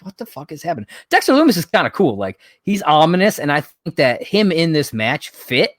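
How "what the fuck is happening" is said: baffled mutter. Retold or imitated. Recapping the match to a friend, a quiet baffled mutter. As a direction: mutter it half to yourself, lost. Muttering at Dexter Lumis in tree.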